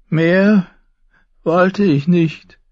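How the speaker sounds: high, slow, loud relief; satisfied madman. A narrator's reading. Brother promised his crown, high slow satisfied voice. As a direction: draw it out high, with a smile. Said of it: "wie er lächelte".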